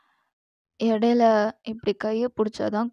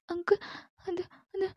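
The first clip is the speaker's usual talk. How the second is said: put-on frightened girl; 9 semitones higher, 10 dB quieter, faster than usual. Scared girl stammers to captor.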